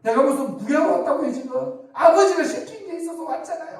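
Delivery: high-pitched hammed-up indignation; aggrieved, tearful protest. Pastor as David saying father sent him, mock aggrieved whine. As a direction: play a wronged kid brother whining back. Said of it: "막 억울해서 막 한나처럼 막 울고".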